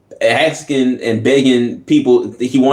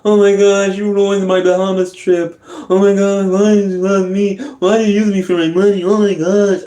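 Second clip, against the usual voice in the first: high, whining, pitched-up mock complaint; 9 semitones higher than usual. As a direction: parody a heartbroken rapper, whining. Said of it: "Talking about".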